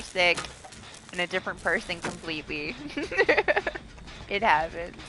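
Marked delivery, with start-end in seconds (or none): none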